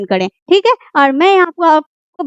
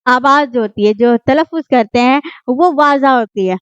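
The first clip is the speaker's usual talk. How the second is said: ordinary talk, nothing marked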